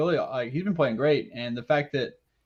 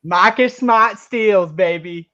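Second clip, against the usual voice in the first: put-on Boston accent; 6 semitones higher, 9 dB louder, much slower than usual. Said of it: "put it in with that Boston accent".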